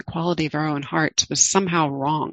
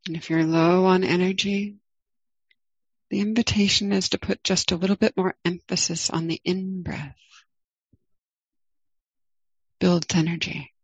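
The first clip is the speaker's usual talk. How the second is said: guided meditation. Acted. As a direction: ease listeners into the body, gently.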